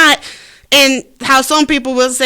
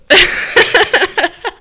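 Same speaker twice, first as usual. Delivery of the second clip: laughing; 7 semitones higher than usual. Real, not acted. Laughs at own joke.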